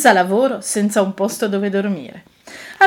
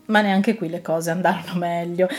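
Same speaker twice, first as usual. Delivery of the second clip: plain reading voice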